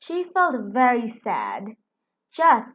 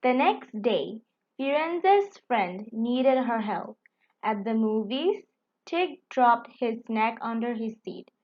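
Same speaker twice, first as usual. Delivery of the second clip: plain reading voice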